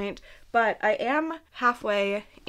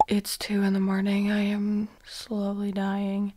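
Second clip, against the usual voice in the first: hushed late-night whisper. Real, not acted. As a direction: whisper so nobody wakes. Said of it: "we have been whispering because Matt is asleep".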